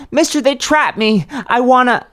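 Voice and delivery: high, loud frantic plea; desperate shouting. Acted. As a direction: yell for rescue, cut off. Boy begging passing stranger.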